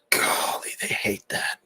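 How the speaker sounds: a sly whispered aside. Real, not acted. Streamer sharing a secret aside with chat, whispered.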